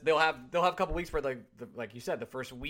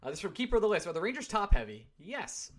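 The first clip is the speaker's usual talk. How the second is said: usual speaking voice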